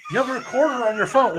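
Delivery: fast, loud, suddenly excited; excited outburst. Real, not acted. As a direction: blurt it out in surprise. Excited realisation.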